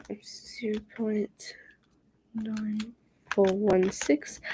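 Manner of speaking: whispering. Real, not acted. Whispered calculation.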